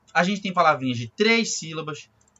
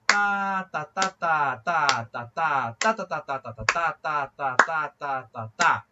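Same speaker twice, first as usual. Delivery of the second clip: even "tá" beats, slightly lower; rhythmic ta syllables; 3 semitones lower, much slower than usual. Shown as a demonstration. Music teacher improvising divisions over the pulse, rhythmic tá syllables. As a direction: tap out a rhythm on tá. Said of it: "se eu inventar qualquer coisa em cima dessa pulsação".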